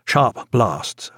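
ordinary delivery, nothing marked

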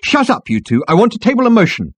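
high, loud strident demand; strident girl. A narrator's reading. Girl interrupts the two men, stridently. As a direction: cut in stridently. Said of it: "A girl with a strident voice".

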